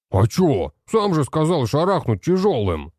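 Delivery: indignant, defensive protest. Acted. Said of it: "завозмущался тот".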